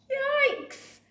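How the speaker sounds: high loud startled yikes. Acted. Betty sees the brew flood the floor, high startled squeal. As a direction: squeal in alarm. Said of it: "Betty said".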